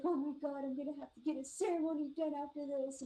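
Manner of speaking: imitating a scared driver's panicky voice; freaked out. Spooked driver to passenger after hitting the creature, freaked out. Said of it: "He was so scared".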